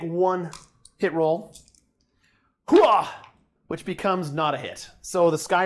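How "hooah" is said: loud gung-ho soldier. Pretend. Battle cry after a reroll.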